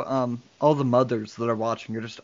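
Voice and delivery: usual speaking voice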